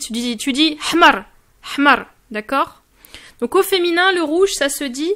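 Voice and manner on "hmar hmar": hmar said quickly, A barely heard; quick short A. Shown as a demonstration. Teacher repeating the right form to a learner, a fast hmar with a short A. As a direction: snap through the A of hmar. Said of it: "Donc, tu vas très vite".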